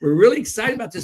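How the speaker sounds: high, fast and loud "We're really excited about this"; excited pitch. Shown as a demonstration. Coach showing salespeople an excited pitch. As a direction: sell it like you mean it. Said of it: "have your hands out there like this and going".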